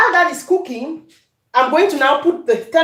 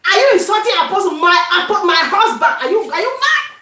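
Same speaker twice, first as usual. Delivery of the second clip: higher, laughing mock fury; playacting an outraged wife; wife defending her husband, hammed-up anger; 6 semitones higher, faster than usual. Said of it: "I said".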